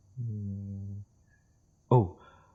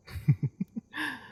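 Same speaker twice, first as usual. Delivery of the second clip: high, light chuckle; amused chuckle. Acted. Dragon to guest about gold thieves, amused high chuckle. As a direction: chuckle lightly at your own remark.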